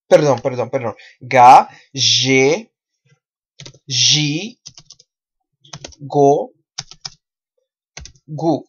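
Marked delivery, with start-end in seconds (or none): 1.2-8.6 "Ga, ge, gi, go, gu" with zh in ge and gi; measured list, hard g except zhe, zhee; syllable drill. Shown as a demonstration. Teacher models Portuguese G syllables, syllable drill. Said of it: "Vamos a repetir".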